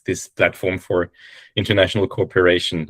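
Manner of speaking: ordinary talk, nothing marked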